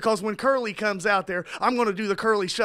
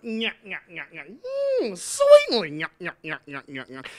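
Curly nyah-nyah noises; 2 semitones lower than usual. Acted.

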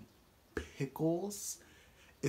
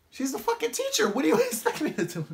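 much higher, loud, laughing mock shriek; exasperated shriek; 12 semitones higher, 6 dB louder, faster than usual. Defending talking to the teacher, shrieking through a laugh. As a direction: squeal it in exasperated disbelief.

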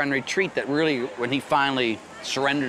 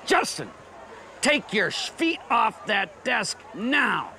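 high-pitched stern order, dragging out the last word; 9 semitones higher, slower than usual. Retold or imitated. Teacher tells student to get his feet off the desk, stern raised voice. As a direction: order him firmly, voice pitched up.

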